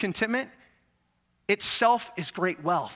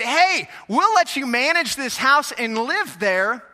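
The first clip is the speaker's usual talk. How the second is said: loud, higher warning, slowed at first; a hammed-up bank giving a warning; mock bank voice; 4 semitones higher, 6 dB louder than usual. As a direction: play the bank threatening the homeowner. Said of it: "They said".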